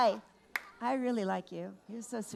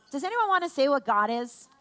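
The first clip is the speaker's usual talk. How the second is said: ordinary talk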